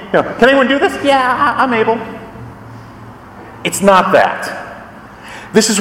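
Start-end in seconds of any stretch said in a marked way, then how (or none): 0.4-0.9 a light mock-timid question; mock a feeble request, slightly higher; wimpy asker. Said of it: "it sounds wimpy". 1.0-2.0 wimpy reply. Retold or imitated. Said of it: "it sounds wimpy".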